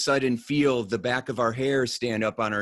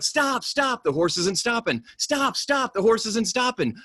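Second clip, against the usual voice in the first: much higher mock panic, pleading with the horse; panicked yelling; 11 semitones higher than usual. Rider on the runaway horse begging it to stop, high mock panic. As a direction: yell at the horse like it won't listen.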